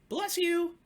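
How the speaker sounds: very high, loud little cub voice; bright high cub piping up. Acted. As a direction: squeak it out like a tiny eager cub.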